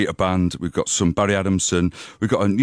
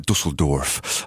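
attempted German pronunciation; German accent; 5 semitones lower, much slower than usual. Shown as a demonstration. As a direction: say it with a half-hearted German accent. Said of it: "tried to do a German accent".

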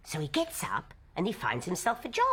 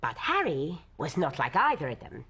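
usual speaking voice

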